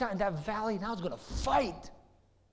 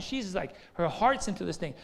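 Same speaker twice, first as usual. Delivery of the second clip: ordinary delivery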